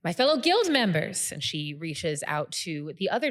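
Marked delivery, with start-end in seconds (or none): 0.0-1.3 high, loud formal oratory; rally the whole room to join you; rousing guild leader. Acted. Guild leader addresses banquet, rousing speech.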